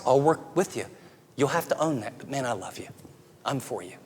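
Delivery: soft, low, gentle reassurance; tender reassurance. Pretend. Parent reassuring a child who failed, softly and tenderly. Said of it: "They need to hear".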